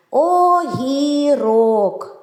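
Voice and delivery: Огірок very slow, syllable by syllable, both О sounds long; виділений звук О. Shown as a demonstration. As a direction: chant out Огірок with held vowels. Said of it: "в цьому слові теж два звуки О". («Огірок» дуже повільно, з протяжними «о»)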